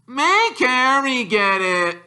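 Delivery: put-on high, slow spoiled-boy voice; Dudley whining. Dudley refusing his father, high slow whine. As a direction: drag it out like a spoiled child.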